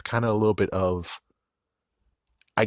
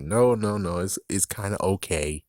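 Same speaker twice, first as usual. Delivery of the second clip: usual speaking voice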